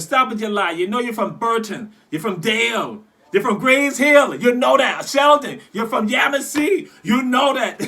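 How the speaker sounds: loud teasing shout, high; retold shout. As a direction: call them out at full volume. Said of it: "I said".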